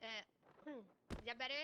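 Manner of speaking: distant voice from across room; far off. Real, not acted. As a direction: speak from far away. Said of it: "No, can you, like, walk out of the building".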